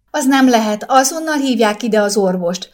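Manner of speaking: put-on a mother refusing to accept the baby; hysterical demand. A narrator's reading. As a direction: insist on the doctor, losing control. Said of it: "követelte hisztérikusan".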